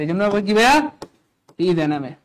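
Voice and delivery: usual speaking voice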